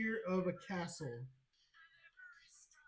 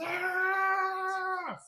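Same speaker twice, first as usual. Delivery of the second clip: long, high, loud "aah" of effort; drawn-out high-pitched lifting yell; straining roar; 12 semitones higher, 4 dB louder than usual. Acted. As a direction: roar through a heavy lift, held long. Game master acts out the lift.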